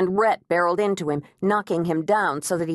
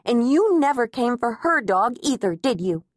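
snapping taunt; 5 semitones higher than usual. A narrator's reading. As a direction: needle him hard. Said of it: "Agnes snapped".

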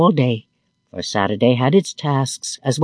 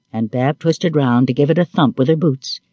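ordinary delivery

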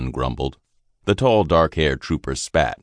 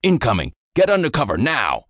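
louder and much higher, yelling orders; urgent warning shout; 9 semitones higher, 3 dB louder than usual. Acted. Sergeant warning his men of incoming bombs, shouting. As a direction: bark the alarm to everyone. Sergeant warns of bomb attack.

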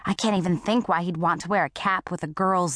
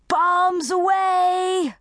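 very high, long drawn-out holler; satisfied broadcast call; 11 semitones higher, 4 dB louder, much slower than usual. A narrator's reading. Duke lets fly from the bridge, satisfied drawn-out call. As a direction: sing it out like a triumphant launch call. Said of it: "broadcast with a great deal of satisfaction".

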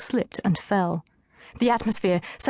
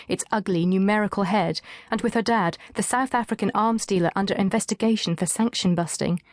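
ordinary delivery, nothing marked

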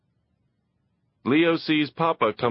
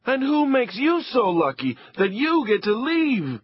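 calling after; 13 semitones higher than usual. Acted. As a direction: shout it at the closing door. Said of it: "His wife calls after him".

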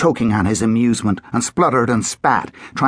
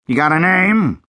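gruff sheriff; 7 semitones higher, 3 dB louder than usual. A narrator's reading. Sheriff asks boy.

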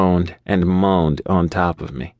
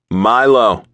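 louder, sharp snap on the name; snapped; 4 semitones higher, 5 dB louder than usual. A narrator's reading. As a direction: snap his name short. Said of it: "he snapped".

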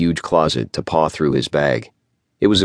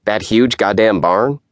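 sneering; 6 semitones higher than usual. Acted. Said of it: "Isher's nasal voice".

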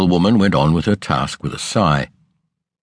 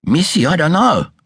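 gasped answer; 12 semitones higher than usual. A narrator's reading. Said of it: "Martha gasped".

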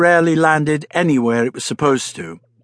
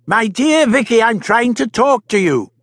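insisting; 10 semitones higher, 4 dB louder than usual. Acted. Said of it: "insisted the Doctor".

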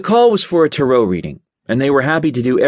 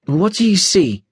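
put-on higher, working-class British accent; 7 semitones higher than usual. Client asking the tarot reader, British accent. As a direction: ask it like a blue-collar British woman. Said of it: "she asked me with a kind of British accent".

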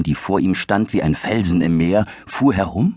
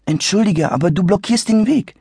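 put-on higher, courteous young voice; a hint of a polite boy; 12 semitones higher than usual. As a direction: apologize but insist.

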